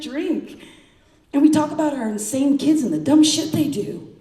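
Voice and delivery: high, loud, punchy spoken-word delivery; impassioned appeal. Acted. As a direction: plead the case to a friend, hitting each point hard. Emphatic appeal to friend in performed poem.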